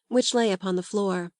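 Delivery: ordinary delivery, nothing marked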